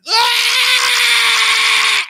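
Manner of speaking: high, loud panicked wail; panicked kid scream. Retold or imitated. Son running out of the room screaming in panic. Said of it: "He's pan".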